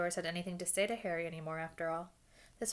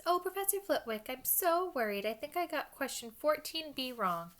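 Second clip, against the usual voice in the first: mock worried pupil, pitched way up; high voice; 8 semitones higher than usual. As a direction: put on a high, fretful mimicking voice. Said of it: "put on a high voice".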